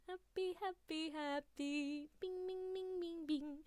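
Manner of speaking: tiny high, soft happy squeal; high, soft, giddy little chirps; giddy squeaking. Real, not acted. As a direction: squeak it repeatedly in delight. Giddy noises from happiness.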